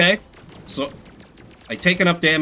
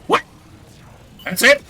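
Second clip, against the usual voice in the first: surprised; 6 dB louder than usual. Real, not acted. Taken out instantly in game.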